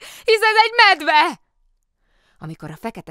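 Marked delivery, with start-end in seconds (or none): 0.0-1.4 very high, loud, drawn-out cry of alarm; alarmed shout. Acted. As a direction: cry out at the sight of a bear. Startled cry at seeing bear.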